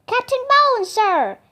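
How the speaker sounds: high, loud call out a window; shout. A narrator's reading. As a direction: shout for the captain's attention. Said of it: "he shouted".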